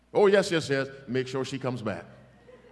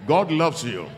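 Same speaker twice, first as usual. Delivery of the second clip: GOD hit loud and higher; louder emphatic repeat; 4 semitones higher, 7 dB louder than usual. Shown as a demonstration. Preacher repeats it louder after a weak amen. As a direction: proclaim it louder with force. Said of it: "That amen was half-starved".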